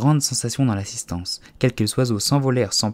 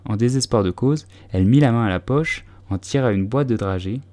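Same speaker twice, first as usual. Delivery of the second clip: usual speaking voice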